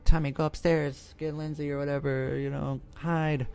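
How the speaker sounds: high-pitched imitation of a worried girl; put-on high voice giving orders; mock urgent babysitter. Babysitter sends the boy upstairs to hide, mock urgent.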